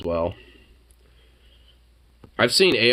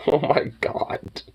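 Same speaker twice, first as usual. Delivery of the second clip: breathless whisper after laughing; 5 semitones higher, slower than usual. Real, not acted. Reader whispering in disbelief at a post. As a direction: hush it out in disbelief.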